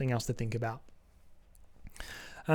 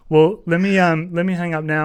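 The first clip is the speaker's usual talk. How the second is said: louder, higher, cheerful wrap-up; upbeat sign-off; 4 semitones higher, 10 dB louder than usual. Real, not acted. Ending the call with Joe, loud cheerful sign-off. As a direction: bounce it out to end the call.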